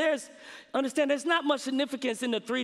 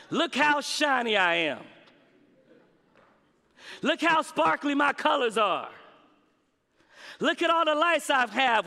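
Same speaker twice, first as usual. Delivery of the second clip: mock boastful preen, rising higher; bragging Christmas tree; 4 semitones higher than usual. Preacher voicing a boastful Christmas tree, mock bragging. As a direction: show off like a proud lit-up tree. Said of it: "like a Christmas tree bragging about being lit".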